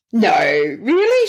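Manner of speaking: high-pitched, incredulous reaction; retold surprise. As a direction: replay your disbelief, jumping up high on "really". Retells own surprised reply to therapist.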